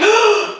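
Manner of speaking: sharp high gasp inward; wrong-way gasp. Retold or imitated. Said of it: "take a deep breath out".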